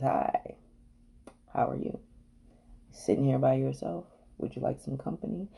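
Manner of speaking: soft, hushed pick-up line; smooth seducer. Acted. Seducer slides up to someone at the bar, smooth and hushed. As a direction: slide up quietly with a come-on. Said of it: "he just slides up and says".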